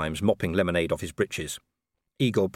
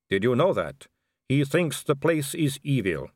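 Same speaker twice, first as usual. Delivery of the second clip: slow, higher, deliberate vampire aristocrat; smooth vampire lady; 7 semitones higher, 4 dB louder, slower than usual. Acted. Vampire warning Vimes of civil war, measured. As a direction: spell out the politics with measured weight.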